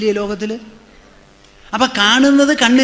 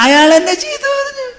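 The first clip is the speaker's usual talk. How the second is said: high, far-off whimper of a hurt devotee; mock the wounded complainer in a much higher voice; whiny complainer; 14 semitones higher than usual. Swami mocking a follower who cries over insults, high and whiny.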